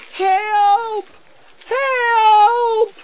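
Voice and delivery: very high, loud, drawn-out yell; desperate shouting. A narrator's reading. Children shouting toward a noise in the cave, desperate shout. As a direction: scream for rescue, stretching the word. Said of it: "they shouted and shouted".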